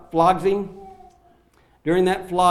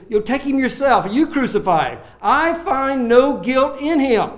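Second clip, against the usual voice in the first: higher and louder, slowing at the end; exasperated outburst; 8 semitones higher, 5 dB louder than usual. Acted. Pilate fed up with the priests, loud and exasperated. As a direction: throw it back at them.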